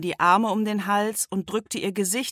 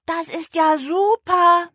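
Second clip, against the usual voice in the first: high, slow, loud squeak; squeaky voice; 11 semitones higher, 6 dB louder, slower than usual. A narrator's reading. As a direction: squeal it out in delight. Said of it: "Stattdessen quietschte er".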